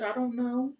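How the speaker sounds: put-on a child barely answering, mumbling softly; mumbling kid. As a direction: mumble it like a kid who can't explain. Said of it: "You ask a kid what's wrong".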